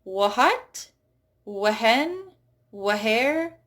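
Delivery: "what", "when", "where" with a breathy H before the W; overdone H in wh words; aspirated wh. Shown as a demonstration. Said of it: "you usually don't hear a".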